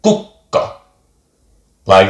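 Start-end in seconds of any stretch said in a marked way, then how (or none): 0.0-0.6 Kukka with a held stop before the second k; Finnish double k. Shown as a demonstration. Teacher modelling Kukka for learners, pausing on the double k. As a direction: say Kukka as kuk-ka, a short catch in the middle. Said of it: "have a little bit of a pause".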